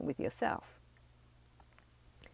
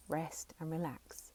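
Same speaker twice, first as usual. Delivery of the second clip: whisper; 7 dB quieter than usual. Shown as a demonstration. Soothing whisper.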